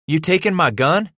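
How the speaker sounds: put-on high, loud boy's voice; dismayed question. A narrator's reading. Son losing gun to father, dismayed. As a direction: rise in disbelief.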